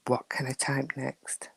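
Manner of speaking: soft imitated thought; whispered echo. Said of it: "She sat there thinking".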